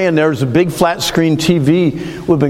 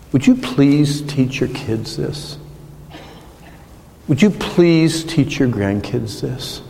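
earnest plea; 4 dB quieter, slower than usual. Acted. Pleading with parents.

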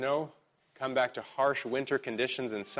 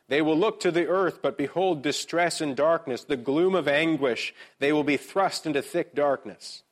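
higher, louder proclaimed verse; emphatic scripture reading; 5 semitones higher, 5 dB louder than usual. Real, not acted. Pastor reads eight twenty-two to the congregation, emphatically.

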